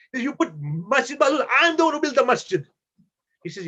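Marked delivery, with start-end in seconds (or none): 0.2-2.7 high, loud imitation of outrage; acted indignant outburst, pitched up; fuming mad. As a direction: protest like the wronged owner. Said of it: "he is fuming mad".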